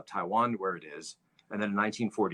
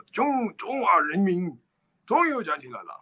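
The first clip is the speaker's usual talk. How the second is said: put-on high, raised Hunan-accented proclamation; Mao's Hunan accent; 9 semitones higher than usual. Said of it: "he had this sort of, like, raspy voice".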